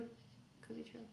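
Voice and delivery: breathy whispered aside. Shown as a demonstration. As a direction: whisper it like a secret. Whispered repeat of same phrase.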